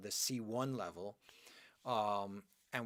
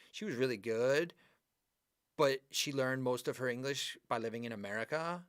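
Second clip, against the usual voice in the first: upspeak, with "verbs", "good" and "America" lifted like questions; rising question intonation. Shown as a demonstration. Teacher mimics the inflection they hate for students, rising question intonation. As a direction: end each phrase on a rise, as if asking. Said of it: "end the sentence with a rising intonation".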